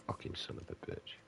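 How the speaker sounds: unvoiced, under the breath swearing; whispered gamer curse. Real, not acted. Gaming frustration muttered.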